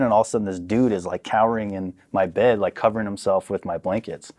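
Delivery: ordinary delivery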